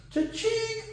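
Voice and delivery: high, soft cha-ching; playful quiet cash-register squeal. Pretend. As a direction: imitate a little till ringing, high and light.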